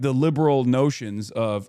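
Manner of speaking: ordinary talk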